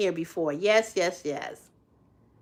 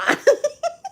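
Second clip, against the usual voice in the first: high laugh. Real, not acted. Cracking up at viewers' tampon joke, high laugh.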